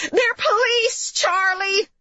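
high, drawn-out mother's yell; yelled warning. A narrator's reading. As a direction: warn your son in a stretched, high-pitched cry. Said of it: "yelled Missus Lanowitz".

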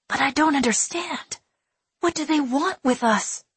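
put-on a child baffled and scared; confused boy. A narrator's reading.

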